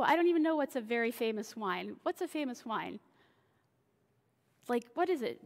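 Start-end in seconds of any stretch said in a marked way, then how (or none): none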